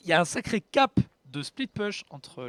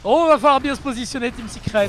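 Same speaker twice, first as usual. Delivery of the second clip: high, loud excited warning; excited shout; 9 semitones higher, 10 dB louder than usual. Real, not acted. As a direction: call out to the team, fired up. Excited call to a team.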